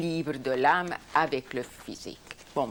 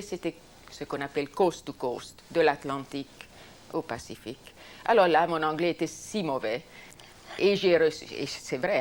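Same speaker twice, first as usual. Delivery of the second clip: normal talking voice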